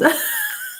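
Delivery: a quick squeaky laugh at chat; light high-pitched giggle. Real, not acted.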